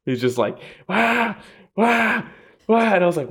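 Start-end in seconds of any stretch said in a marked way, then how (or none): 0.9-2.9 high mock baby wail, wah wah wah. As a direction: imitate a fighter whining like a crying child, pitched high. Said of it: "just starts screaming".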